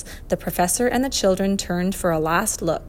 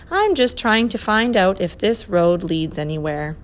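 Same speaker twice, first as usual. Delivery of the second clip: usual speaking voice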